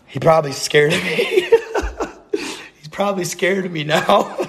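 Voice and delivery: breaking into high giggles; real laughter taking over the words; laughing. Real, not acted. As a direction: crack up while saying it. Cracks up at scaring the neighbor.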